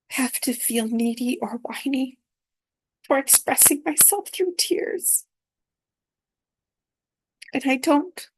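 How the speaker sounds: breaking into a whisper, voice catching high; words slip out half-whispered, close to tears; choked-up whisper. Real, not acted. Recalling tears of joy, voice cracking into a whisper. Tearful retelling of crying in dream.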